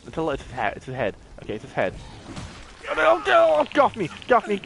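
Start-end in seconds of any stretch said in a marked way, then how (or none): 2.9-4.6 high, loud burst of alarm; panicked protest. Real, not acted. Player fending off an attacker in a game, panicked. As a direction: cry out as if grabbed.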